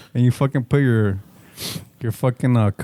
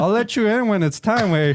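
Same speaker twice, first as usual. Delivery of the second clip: mock protective; 6 semitones higher than usual. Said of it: "and you're like".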